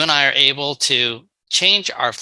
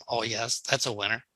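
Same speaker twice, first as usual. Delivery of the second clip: dry, much softer mock approval; sarcastic; 3 semitones lower, 11 dB quieter than usual. Speaker mocking manipulation as a sales goal, sarcastic and soft. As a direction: say it like it's obviously a terrible idea.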